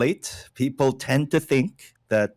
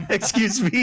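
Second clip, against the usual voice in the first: laughing; 10 semitones higher than usual. Real, not acted. Reaction to joke, laughing.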